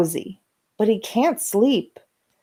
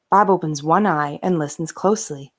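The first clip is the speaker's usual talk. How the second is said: usual speaking voice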